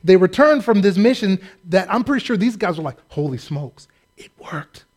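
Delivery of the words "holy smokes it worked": hushed, amazed whisper of disbelief; awed whisper. Retold or imitated. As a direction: breathe it out in quiet wonder. Disciples amazed after their mission.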